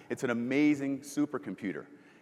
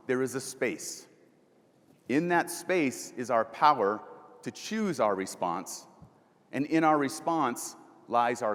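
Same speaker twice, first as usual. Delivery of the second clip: quoting slowly; slower than usual. Shown as a demonstration. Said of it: "here's a great quote".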